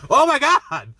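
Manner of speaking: high, loud viewer's gasp of horror; shocked cry. Acted. Viewer reacting to the man being in the room, shocked.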